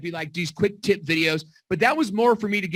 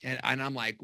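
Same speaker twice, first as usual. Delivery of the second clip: normal talking voice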